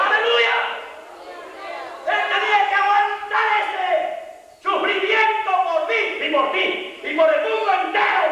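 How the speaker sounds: high-pitched revival preacher's shout; fervent, high, impassioned preaching; full-force preaching. Real, not acted. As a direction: cry it out to the congregation.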